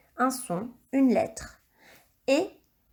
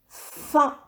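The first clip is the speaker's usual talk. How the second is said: dictation voice; 4 semitones higher, 4 dB louder than usual. Shown as a demonstration. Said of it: "je le veux de deux manières différentes".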